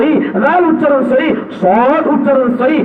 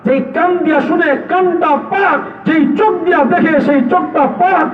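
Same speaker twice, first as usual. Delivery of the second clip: preaching at full force; 5 semitones higher, slower than usual. Acted. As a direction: thunder it out to the congregation. (জোরালো ওয়াজের সুরে)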